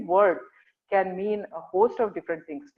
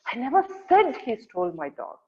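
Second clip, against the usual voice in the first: "said" raised high and punched; stress on SAID; 5 semitones higher than usual. Shown as a demonstration. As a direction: hit the word "said", rest falling away. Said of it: "emphasizing different words".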